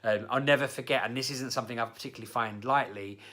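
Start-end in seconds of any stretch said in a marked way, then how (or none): none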